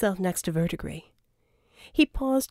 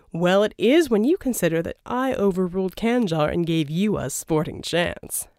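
louder, smooth persuasive schemer; a charming villain's pitch, louder; Verdigris voiced; 4 dB louder than usual. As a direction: sell the deal, oily and grand. Verdigris brushing off betrayal.

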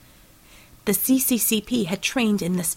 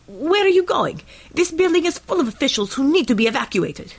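high, raised voice of an alarmed official; calling out; 8 semitones higher, 4 dB louder than usual. A narrator's reading. Chief director to Natalia as she sprints for the door, calling out. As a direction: call urgently after someone leaving.

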